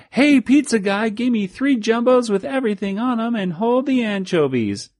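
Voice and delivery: high, hollered order from far above; yelling from the tower. Retold or imitated. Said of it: "they yell out of the water tower".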